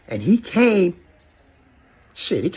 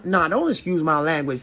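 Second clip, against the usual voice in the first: ordinary talk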